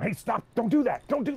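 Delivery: high, loud urgent yell; cops yelling. Retold or imitated. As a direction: shout to stop him driving off. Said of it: "the cops start yelling at him".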